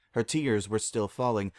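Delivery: plain reading voice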